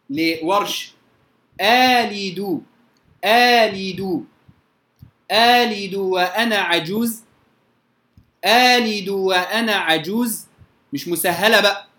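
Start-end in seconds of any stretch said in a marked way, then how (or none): 1.6-4.2 آلد drawn out slowly on one long aa; long alif replacing hamza. Shown as a demonstration. Quran teacher drilling students, slow drawn-out aa. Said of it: "ولنا الإبدال فيها". 5.3-10.5 آلد opened on one long aa, no second hamza; long alif replacing hamza. Shown as a demonstration. Quran teacher reciting the ibdal reading to students. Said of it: "ولنا الإبدال فيها".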